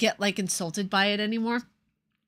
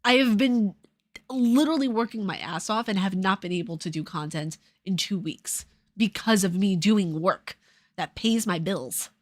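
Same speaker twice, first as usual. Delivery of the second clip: ordinary talk, nothing marked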